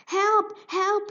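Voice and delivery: high, loud cry for help; frantic mouse shouting; panicked shout. Acted. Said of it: "he shouted".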